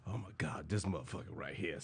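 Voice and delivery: very low, soft grumble; muttered exasperation. Acted. Cab driver about the guy ahead, quiet mutter. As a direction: grumble it under your breath.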